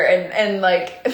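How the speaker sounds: ordinary talk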